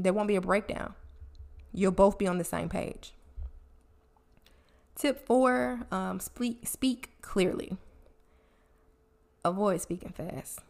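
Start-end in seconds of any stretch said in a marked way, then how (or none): none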